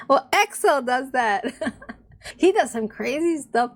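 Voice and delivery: amused and fond, breaking into laughs; laughing. Real, not acted. Answering herself, laughing.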